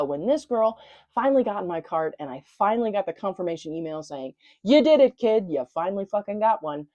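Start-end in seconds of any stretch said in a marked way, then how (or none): 4.6-6.9 mock congratulations. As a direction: cheer yourself on as the email would. Acts out confirmation email congratulating her.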